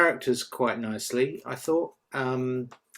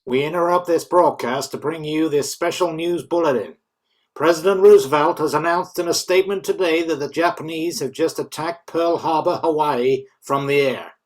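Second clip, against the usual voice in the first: loud, higher radio announcer; news bulletin; 4 semitones higher, 8 dB louder than usual. Acted. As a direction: announce the attack urgently.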